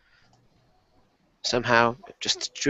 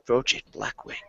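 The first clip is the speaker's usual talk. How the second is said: mumbled; 2 semitones lower, 7 dB quieter than usual. Acted. Tearful captive mumbling a secret, softer. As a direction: let it slip out under the breath. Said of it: "he just kinda mumbles out".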